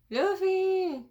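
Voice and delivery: high, loud shout of a name; acted high yell; calling out. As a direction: holler it, stretching the end. Voices a character calling Luffy.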